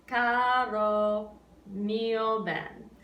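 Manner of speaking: "Caro mio ben" very slow, syllables spaced evenly; even rhythmic speaking. Shown as a demonstration. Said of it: "we just pretend like there's another little note".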